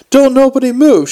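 high, loud yelled command. A narrator's reading. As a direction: yell it to freeze the whole room. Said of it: "she yelled".

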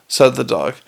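usual speaking voice

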